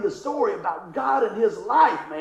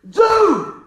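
excited; 9 semitones higher, 8 dB louder than usual. Retold or imitated. Buddy's excited exclamation.

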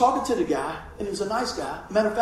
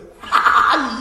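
loud laugh; 4 semitones higher, 8 dB louder than usual. Real, not acted. Laughing at doctor's question.

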